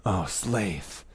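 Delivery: soft mock swoon, slow end; drawn-out lovestruck gush; swooning admirer. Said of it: "It's just like".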